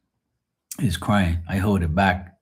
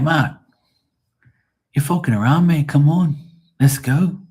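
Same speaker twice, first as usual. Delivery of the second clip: high, loud mock English accent; English coworker; 7 semitones higher, 5 dB louder than usual. Imitating an English coworker chivvying him, English accent. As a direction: hurry him along in a pitched-up British accent. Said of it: "I used to work with this English guy".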